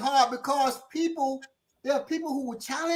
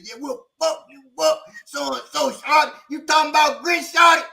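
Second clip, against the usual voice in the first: raised, high mock threats; acted-out heckling, rising to a shout; angry crowd, imitated; 5 semitones higher, 4 dB louder than usual. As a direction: pile on like an offended mob. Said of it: "set off a bunch of people said".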